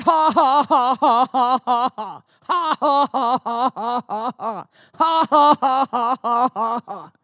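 loud deliberate ha ha ha, pushed out on purpose; forced put-on laughter. Preacher retelling a defiant forced laugh at the devil, loud and put-on. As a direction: fake a hearty laugh at the devil. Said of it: "I put on my laughter".

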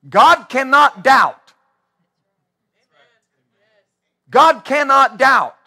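slow, loud, measured proclamation; preacher driving it home, slow and loud; emphatic preaching. Acted. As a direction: hammer each word home from the pulpit. Preacher pounding the point.

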